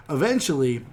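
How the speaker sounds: ordinary talk, nothing marked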